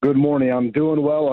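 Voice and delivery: hoarse, worn-out voice. Real, not acted. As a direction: speak through a lost voice. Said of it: "I've lost my voice six days ago".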